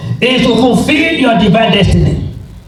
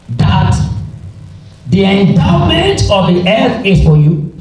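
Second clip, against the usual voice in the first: ordinary delivery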